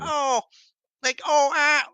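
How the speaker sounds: high nasal imitation of the aunt. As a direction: squeeze it through the nose, high and loud. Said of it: "She had this fucking nasally voice".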